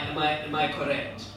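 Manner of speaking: ordinary talk, nothing marked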